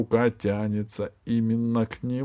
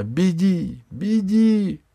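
нарастающий высокий крик; кричать подруге, чтобы спасалась; 9 semitones higher, 4 dB louder, much slower than usual. Acted. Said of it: "а Алиса кричала". (Алиса кричит Ирии, нарастая)

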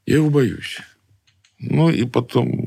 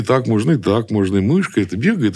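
ordinary talk, nothing marked

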